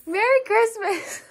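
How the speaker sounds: high, loud Christmas cheer; excited greeting. Real, not acted. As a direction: call it out brightly.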